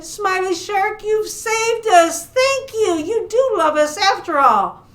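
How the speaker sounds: high, gushing storybook gratitude; joyful thanks. Acted. Rescued fish thank their shark, overjoyed.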